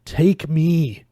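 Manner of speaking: pained, pleading groan; moaned plea. A narrator's reading. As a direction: moan it as if badly hurt. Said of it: "Pouncy moaned".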